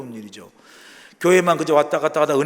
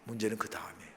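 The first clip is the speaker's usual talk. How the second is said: much softer, low and grave; hushed repeat; 5 semitones lower, 16 dB quieter, faster than usual. Real, not acted. Preacher repeats the warning to the congregation, hushed. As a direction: let the warning drop almost to nothing.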